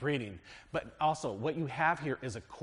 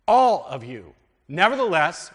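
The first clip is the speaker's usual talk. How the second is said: raised, higher voice proclaiming the verse; scripture reading; 3 semitones higher, 13 dB louder than usual. Acted. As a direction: read it out like a preacher at the pulpit. Reads scripture aloud.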